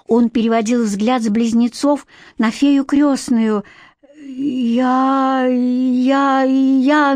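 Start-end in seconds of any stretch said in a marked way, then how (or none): 4.2-7.1 stammering. A narrator's reading. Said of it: "начал было он".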